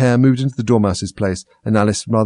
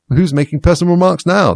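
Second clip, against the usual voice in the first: triumphant; 10 semitones higher, 7 dB louder than usual. A narrator's reading. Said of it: "the Hatter asked triumphantly".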